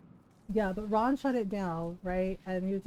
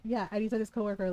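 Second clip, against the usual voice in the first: ordinary talk, nothing marked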